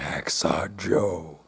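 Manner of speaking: low, very slow menacing name; playful spooky voice. Acted. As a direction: growl the name slowly.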